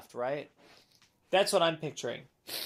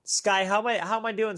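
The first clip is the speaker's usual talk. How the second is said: ordinary delivery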